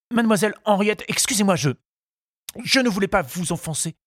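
high, stammering suitor's voice; flustered apologetic suitor. A narrator's reading. Charles apologises to Henriette after the kiss, flustered. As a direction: apologise in a high, anxious rush.

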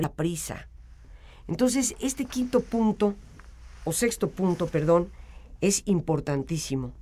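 usual speaking voice

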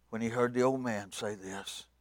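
ordinary talk

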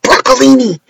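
loud, high gleeful Broccolini; shouting "Broccolini!" in delight; exclaimed. Retold or imitated. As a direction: sing it out like a joke. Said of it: "and all I said was".

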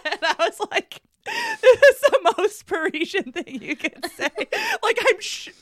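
high, loud, laughing through the words; can barely get it out for laughing. Real, not acted. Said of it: "laughing while telling".

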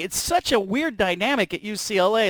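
ordinary talk, nothing marked

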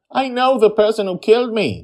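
put-on high, loud insistent girl; forceful insistence. A narrator's reading. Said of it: "Joy insists forcefully".

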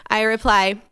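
ordinary talk

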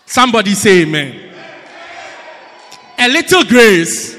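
loud, driving sermon delivery; full-force preaching. Acted. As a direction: preach it out to the congregation.

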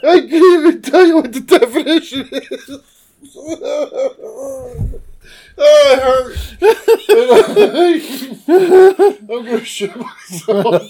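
high, laughing through the words; can barely speak for laughing; helpless laughter. Real, not acted.

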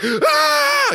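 loud, very high scream; acted yell. Husband in one of his seven times yelling, acted scream. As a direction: let out one piercing, frustrated scream. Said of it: "we're just yelling and talking very passionately".

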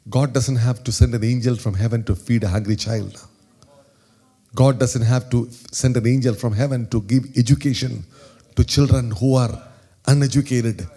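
rising preacher's cadence; preach. Real, not acted. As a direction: build the refrain like a sermon. Preaching refrain.

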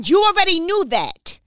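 a loud scold to stop playing with God; fired-up rant. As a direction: lay into her, fired up and scolding. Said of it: "Fired up".

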